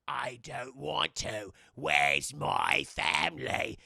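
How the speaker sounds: very low, slow ghost lament; a lost old ghost asking for family. Retold or imitated. Ghost Bill voice.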